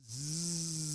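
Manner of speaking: sustained buzzing zzz on the out-breath; slow controlled zzz exhale; long zzz. Shown as a demonstration. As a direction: let the breath out on a steady zzz. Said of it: "So that was a three-second zzz sound".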